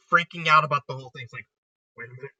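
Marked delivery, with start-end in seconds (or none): 2.0-2.3 a soft, stunned murmur under the breath; quiet shocked mutter; hushed realization. Retold or imitated. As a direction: say it almost to yourself as it dawns. Kaiba's dawning realization, quietly.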